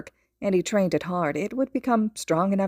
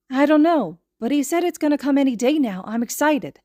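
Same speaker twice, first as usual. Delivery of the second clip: much higher, louder happy little voice; excited child; 8 semitones higher, 5 dB louder than usual. A narrator's reading. Little boy excitedly tells his friend about his quirk. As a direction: bubble over with excitement.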